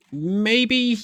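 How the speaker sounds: high, teasing little reply; lilting teasing maybe; coy maybe. Pretend. Coy answer about alliance.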